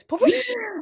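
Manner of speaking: high playful whoosh noises; swooshing sound. Retold or imitated. Cohost playfully swooshing in to save the day. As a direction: mimic a swoop, giggling. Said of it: "Welcome to Grace to Grace".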